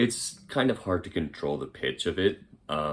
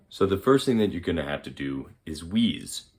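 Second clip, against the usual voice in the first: ordinary talk, nothing marked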